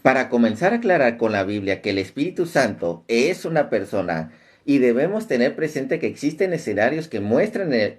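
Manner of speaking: normal talking voice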